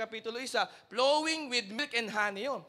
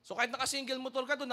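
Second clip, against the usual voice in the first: normal talking voice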